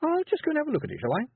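put-on high, syrupy sweet old lady; sticky sweet voice. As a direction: coo it with fake innocence. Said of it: "in a sticky, sweet voice".

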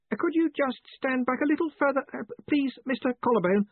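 high, hesitant character voice; polite assistant. A narrator's reading. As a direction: make a tactful request without offending. Ponder politely instructs the caller.

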